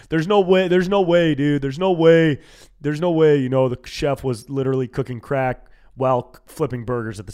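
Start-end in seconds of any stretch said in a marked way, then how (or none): 0.1-3.4 mock disbelief. Mock disbelief about Waffle House.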